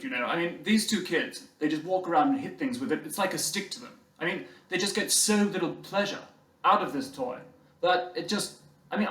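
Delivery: put-on posh English boy's smug claim. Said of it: "I'm leaning into the bit".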